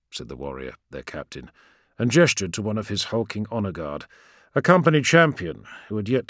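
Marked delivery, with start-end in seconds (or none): none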